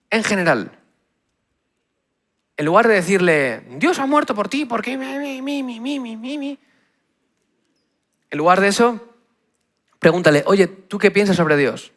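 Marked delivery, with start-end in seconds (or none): 3.8-6.6 high, thin, hammed-up churchy singsong; mock preachy voice. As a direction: parody a believer who suddenly sounds possessed. Mocking preachy believer's weird voice.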